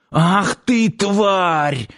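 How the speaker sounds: high, drawn-out furious wail; pained howl. A narrator's reading. Man splashed in the eyes curses her, howling. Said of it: "завыл откатившийся в сторону мужик".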